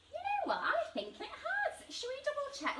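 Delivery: high, laughing delight; bubbling with surprised joy; excited. Real, not acted.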